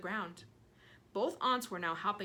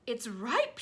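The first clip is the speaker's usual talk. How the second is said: excited cry; 3 semitones higher, 5 dB louder than usual. Acted. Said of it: "she cried".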